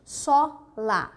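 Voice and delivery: "Só" with a wide open o, then "lá" apart; open strong O. Shown as a demonstration. Teacher returning to the open vowel, open strong O. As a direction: split "Só, lá" and open the first vowel. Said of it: "ele é o som aberto e forte".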